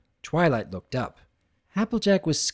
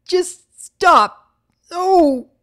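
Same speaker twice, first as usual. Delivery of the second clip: much higher, louder scream; shriek; 9 dB louder, much slower than usual. Acted. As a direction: scream and break away. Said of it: "Twilight shrieked".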